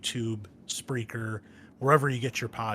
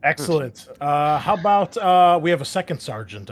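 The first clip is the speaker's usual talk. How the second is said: louder, higher, keep the show moving; loud host call; 6 semitones higher, 14 dB louder than usual. Real, not acted. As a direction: call out to the table. Host calling next player.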